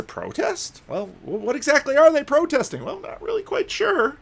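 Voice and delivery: high incredulous baffled tone. Real, not acted. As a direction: sound baffled. Incredulous mocking question.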